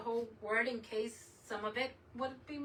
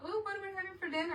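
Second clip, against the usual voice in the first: mock chirpy, much higher and fast; mimicking coworkers; 9 semitones higher, much faster than usual. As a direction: rattle it off like a cheerful coworker. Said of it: "they were talking about".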